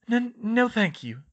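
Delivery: ordinary delivery, nothing marked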